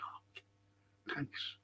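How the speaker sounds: whispered, unvoiced thanks. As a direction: breathe it out sheepishly. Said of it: "I said".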